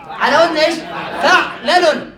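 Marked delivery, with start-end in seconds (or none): 1.2-2.1 «فعلل» as fa'-la-lun, both vowels a; due fathe. Shown as a demonstration. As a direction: say «فعلل» with short a on fa and first lam. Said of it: "يعني فتحتان".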